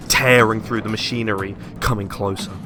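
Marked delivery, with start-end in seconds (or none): none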